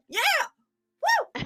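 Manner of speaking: imitated fan at the back; mock crowd whoop; lone audience cheer. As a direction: cheer by yourself from a puzzled crowd. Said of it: "the person back goes".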